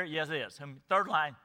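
ordinary talk

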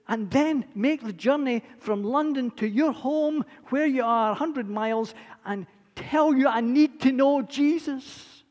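eager retelling. Retold or imitated. Burly man retells his eagerness.

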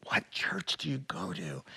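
a secretive whisper, barely audible. Retold or imitated. Nervous churchgoer whispering his faith in a crowded post office. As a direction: whisper it as if afraid of being overheard. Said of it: "he lowered his voice to where I could barely hear him".

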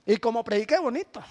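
sarcastic mock praise. Sarcastic remark about preacher.

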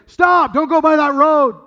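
loud, high, slow command; stern, authoritative shout. Acted. Parent calling to a kid headed towards the road, stern shout. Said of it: "in a real stern voice".

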